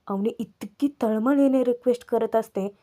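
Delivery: ordinary talk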